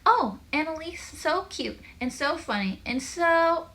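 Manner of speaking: high, drawn-out cooing; mock gushing. A narrator's reading. Joel mimics everyone fawning over the sister, mock gushing. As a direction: gush in a high, exaggerated voice.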